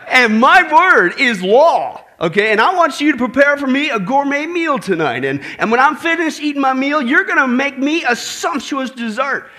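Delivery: booming, bossy husband voice; roaring tyrant king. Retold or imitated. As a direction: puff up and lay down the law. Bill's demands continue.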